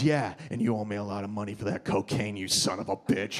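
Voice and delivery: low menacing dealer threat; menacing bouncer voice. Retold or imitated. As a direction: growl it like a thug.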